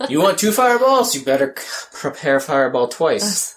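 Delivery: high, loud, snooty mock lecture; mock rules lawyer. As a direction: sneer out the rule like a stickler. Mocking the rules to a wizard.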